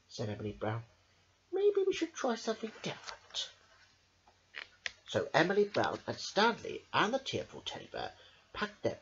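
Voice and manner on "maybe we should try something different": put-on high little girl voice. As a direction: reassure the teddy brightly. Emily voiced in read-aloud story.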